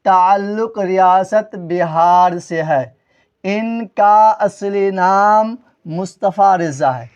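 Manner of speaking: slow, drawn-out classroom reading; slow dictation. Shown as a demonstration. Teacher reading example sentences to students, slow dictation. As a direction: read it out very slowly, word by word.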